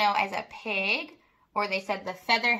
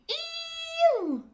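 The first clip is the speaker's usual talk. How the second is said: squealing hog. Acted. The sprayed hog squealing in disgust, very high. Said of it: "who screamed".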